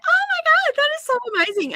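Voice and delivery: high, squealing delight at the news; excited squeal. Retold or imitated. Reading her email, squealing with delight. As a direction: gush it out like thrilled news. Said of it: "I'm like".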